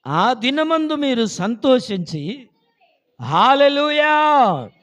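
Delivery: slow, high pulpit shout; drawn-out revival call at full voice; loud proclamation. Real, not acted. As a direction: cry the verse out, then shout praise. Proclaims verse, calls out praise.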